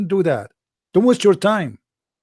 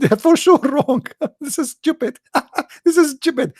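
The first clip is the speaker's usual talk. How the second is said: much higher, laughing scorn; mocking caller; 10 semitones higher than usual. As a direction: sneer it out with a laugh. Caller sure it's wrong.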